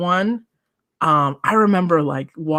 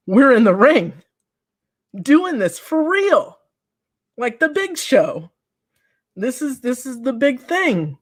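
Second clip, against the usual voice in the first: thrilled disbelief; 7 semitones higher than usual. Retold or imitated. Wrestler reliving her first big match, much higher and thrilled. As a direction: sound overwhelmed with excitement.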